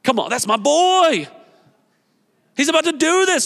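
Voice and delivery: Jesus cheering Peter on like a proud dad; pumped-up Jesus. Retold or imitated. Preacher voicing Jesus, excited and proud. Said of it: "getting pumped up".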